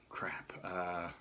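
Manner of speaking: hushed whispered curse under breath. Retold or imitated. Husband whispers to himself seeing wife's asthma attack, hushed. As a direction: whisper it to yourself in dismay. Dismayed thought while wife struggles.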